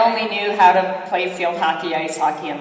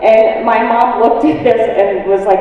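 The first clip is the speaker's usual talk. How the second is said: ordinary delivery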